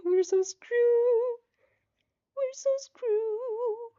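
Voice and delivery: tiny high voice, slowly stretched out; squeaky mock despair. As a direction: whimper it like a cartoon mouse in doom. Streamer despairs at game outcome.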